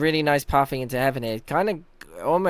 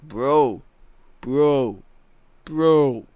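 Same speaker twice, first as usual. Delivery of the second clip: loud, drawn-out "bro" at normal pitch; Leo impression; 8 dB louder, much slower than usual. Streamer showing another teammate's bro, loud stretched mimicry. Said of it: "Leo says this".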